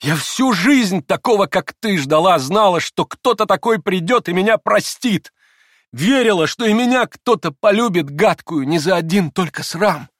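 high, loud impassioned outpouring; overwrought, passionate cry; frenzied confession. A narrator's reading. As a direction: pour it out fervently, as if in a frenzy. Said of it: "как бы в выступлении".

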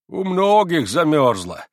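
calm and sententious. Acted. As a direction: answer like an unhurried wise old peasant. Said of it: "спокойно и сентенциозно промолвил".

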